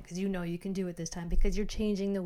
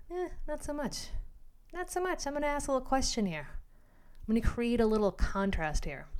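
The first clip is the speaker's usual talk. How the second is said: high, airy dismissive voice; breezy new self; 5 semitones higher than usual. Retold or imitated. The dieter shrugging off a craving, high and airy. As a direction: toss it off lightly, way up high.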